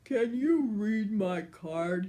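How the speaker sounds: slow, pleading giant voice; a giant begging the wolf for help; giant asking. A narrator's reading. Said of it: "he asked the big bad wolf".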